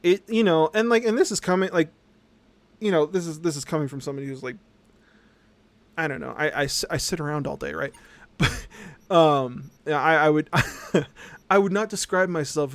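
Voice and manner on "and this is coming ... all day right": ordinary delivery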